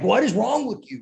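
high-pitched exasperated outburst; mock yelling at a drive-thru worker; retold angry yell. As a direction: imitate snapping in exasperation. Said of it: "I'm yelling at her".

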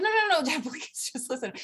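high, laughing through the no's; laughing plea. Retold or imitated. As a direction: beg dad while cracking up. Pleading with dad while laughing.

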